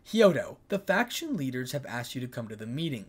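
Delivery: usual speaking voice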